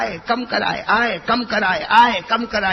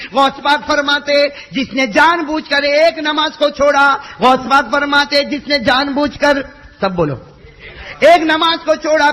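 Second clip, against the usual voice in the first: high, loud stern sermon; preaching at full force; 8 semitones higher, 9 dB louder than usual. Acted. Preacher warning listeners who miss prayers, stern loud. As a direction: hammer out the fatwa to the crowd. Preaching Ghaus Pak's fatwas.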